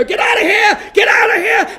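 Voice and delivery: high, loud panicked yelling; panicked shout. Retold or imitated. Teen yelling at his girlfriend to flee as parents arrive, shouting. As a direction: scream her out of the room in a panic. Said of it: "and just go".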